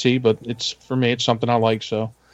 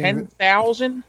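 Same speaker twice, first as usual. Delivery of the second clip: incredulous; 8 semitones higher, much slower than usual. Real, not acted. Doubting the production numbers.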